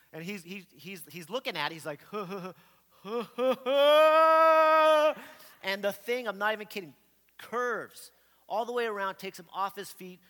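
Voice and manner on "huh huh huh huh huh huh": quick huhs building into a long loud wail; mounting alarmed hoots. Retold or imitated. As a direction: imitate rising panic as it comes closer. Brother watching the oar fly in.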